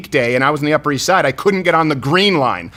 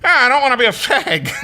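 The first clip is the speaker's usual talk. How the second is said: higher mocking imitation of the guest; mimicking Nick; 8 semitones higher than usual. Host teasing the guest by quoting him, higher and laughing. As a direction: put on Nick's gruff refusal, pitched up with a laugh. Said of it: "you said".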